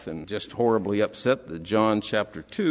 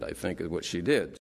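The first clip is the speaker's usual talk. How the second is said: usual speaking voice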